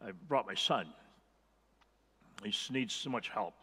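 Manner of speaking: a father telling Jesus, quietly and sadly, that his son needs help; quiet pleading. Acted. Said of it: "And the man said".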